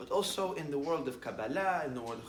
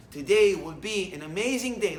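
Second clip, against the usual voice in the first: loud declaration; 3 semitones higher, 12 dB louder than usual. Shown as a demonstration.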